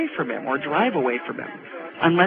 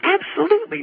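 incredulous; 12 semitones higher than usual. Real, not acted. Incredulous reply to caller.

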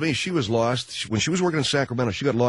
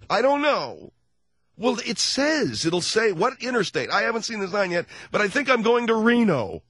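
wife's voice, high; 12 semitones higher than usual. Retold or imitated. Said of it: "wife voice continues".

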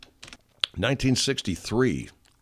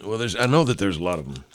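ordinary talk, nothing marked

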